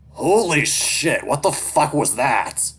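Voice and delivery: high, loud, drawn-out shocked yell; shocked outburst. Acted. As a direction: burst out in slow, loud disbelief. Character reacts to shocking sight.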